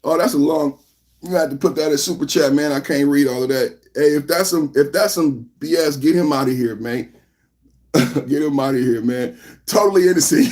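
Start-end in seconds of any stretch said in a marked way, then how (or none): none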